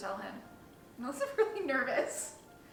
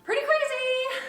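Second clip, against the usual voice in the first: excited; 13 semitones higher, 8 dB louder than usual. Real, not acted. Reacting to surprise pregnancy.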